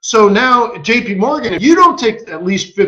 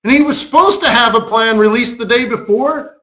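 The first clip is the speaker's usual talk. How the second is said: ordinary talk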